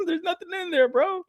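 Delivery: high, giggling mock outrage; retelling the theft, high and laughing; laughing disbelief. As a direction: laugh through the question. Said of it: "I was like".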